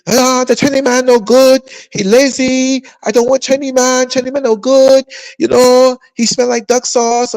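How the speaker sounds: a hammed-up Chinese woman complaining in a put-on Chinese accent; mock Chinese accent. Imagined Chinese woman badmouthing Chinese men, mock Chinese accent. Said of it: "You don't see no Chinese woman on the daggone YouTube".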